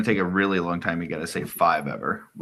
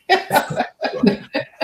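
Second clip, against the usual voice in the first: high breathy laugh breaking out; can't hold the laugh in; burst of laughter; 5 dB louder than usual. Real, not acted. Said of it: "I couldn't even say it without laughing".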